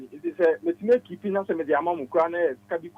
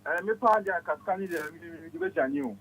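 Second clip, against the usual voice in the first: shy soft voice; 9 semitones higher, 10 dB quieter than usual. Real, not acted. Guest speaks, high and very soft.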